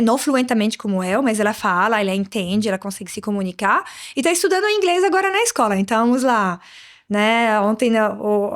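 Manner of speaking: normal talking voice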